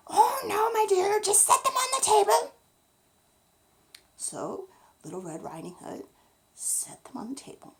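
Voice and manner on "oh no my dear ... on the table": higher, louder put-on old-lady voice; wolf faking grandmother, a bit off; the wolf's fake grandma voice; funny voice. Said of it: "a voice that sounded a little funny".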